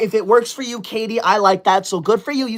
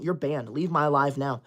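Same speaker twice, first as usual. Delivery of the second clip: low, quiet, cold dismissal; 7 semitones lower, 8 dB quieter than usual. Real, not acted. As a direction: say it flat and final. Banning a viewer coldly.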